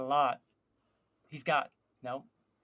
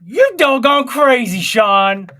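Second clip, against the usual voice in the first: mock scolding shout; 4 semitones higher, 10 dB louder, slower than usual.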